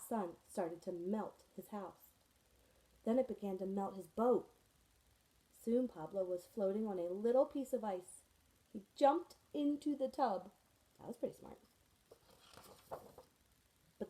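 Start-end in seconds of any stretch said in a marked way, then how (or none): none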